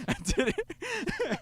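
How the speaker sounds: high, slow, laughing gloat; gleeful kid cackling over his prank; giggling brag. Retold or imitated. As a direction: squeal it through laughter. Boy giggling over his deed.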